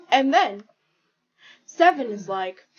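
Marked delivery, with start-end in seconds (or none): none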